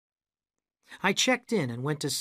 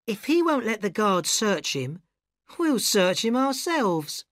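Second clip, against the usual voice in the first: higher, slow sly threat; scheming woman; 7 semitones higher, 4 dB louder, slower than usual. A narrator's reading. Annie threatening to search the narrator herself. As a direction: relish the threat.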